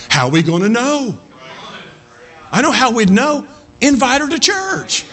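high, insistent call from the pulpit; exhorting preacher. Acted. Preacher urging church to invite a neighbor, exhorting. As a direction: drive the challenge home, pitch climbing.

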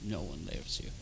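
very low, very quiet taunt; mocking voice. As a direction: imitate a mocking voice murmured in the ear. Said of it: "it was mocking, it was laughing".

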